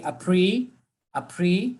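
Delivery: "appre-" alone, drawn out with a rising end; word cut in two. Shown as a demonstration. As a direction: stop after "appre-", lifting the last syllable. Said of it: "So we cut it in two".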